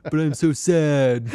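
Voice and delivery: mopey laughing lament; Silver Surfer. Pretend. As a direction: sigh it out like a sad space hero. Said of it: "That's my Silver Surfer impression".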